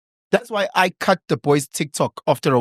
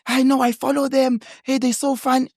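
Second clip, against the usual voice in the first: put-on higher, gushing Afrikaans-accented lady; 6 semitones higher than usual. Mother imitating an Afrikaans lady fan in a shop, Afrikaans accent. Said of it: "What, what accent is that?".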